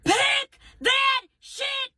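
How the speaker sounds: high, drawn-out furious scream; losing it. Acted. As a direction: explode at the kid, word by word. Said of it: "Now I'm like".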